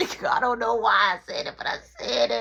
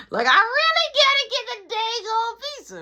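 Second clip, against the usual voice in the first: exasperated wail; 13 semitones higher, 4 dB louder than usual. Acted. Stuck having to buy the pizza, exasperated high wail. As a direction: wail it, cornered and fed up. Comic despair, lie became real.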